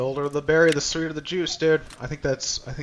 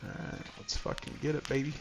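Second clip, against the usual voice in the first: whispered hype; 10 dB quieter than usual. Real, not acted. Hyping up before the raid.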